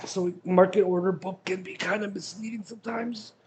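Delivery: strained voice mid-stretch; talking while stretching. Real, not acted. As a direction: speak while stretching your body. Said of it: "So I'm stretching".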